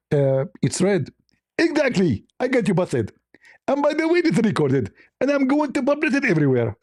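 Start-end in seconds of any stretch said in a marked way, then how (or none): 1.6-6.8 high put-on voice of an angry caller; high scolding impression voice; mock Zakir Naik. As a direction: mimic the offended debater, pitched up. S0 acts out Zakir Naik phone call.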